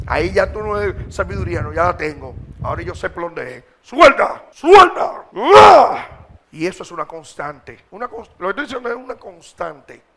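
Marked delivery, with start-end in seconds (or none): 3.8-5.2 much higher, very loud release order; shouted command. Shown as a demonstration. Preacher as a lion, shouting at the spirit to let go. 5.3-6.1 a sudden triumphant yell; loud high battle shout. Shown as a demonstration. Preacher shouting after casting out the spirit.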